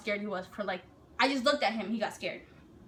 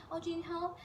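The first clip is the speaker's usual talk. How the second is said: higher, much softer sweet offer; falsely nice; 6 semitones higher, 9 dB quieter than usual. Retold or imitated. Drill sergeant offers to help a recruit, falsely nice. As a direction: act helpful and gentle, softly. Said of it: "They were nice".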